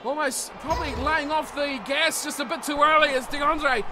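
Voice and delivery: usual speaking voice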